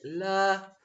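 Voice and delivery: "le" held long and pushed; middle-syllable stress. Shown as a demonstration. Teacher testing options in elephant, stress on "le". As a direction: stretch out "le" as a stressed beat. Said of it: "Which syllable is stressed".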